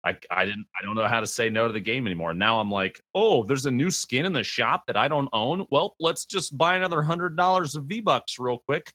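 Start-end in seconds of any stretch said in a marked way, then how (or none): 3.1-8.9 mock excited. Mocking own spending habit.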